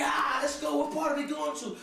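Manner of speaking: mock excited older brother; eager brother. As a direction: hype brother barging in. Brother jumping in shower.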